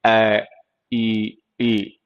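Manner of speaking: ए and ई sounded out slowly with the mouth open; teacher sounding out vowels; vowel sound demo. Shown as a demonstration. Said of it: "ये जो sound है ई के".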